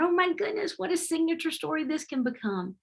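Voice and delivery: thrilled realisation; delighted surprise. Retold or imitated. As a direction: pitch leaps way up on oh my goodness.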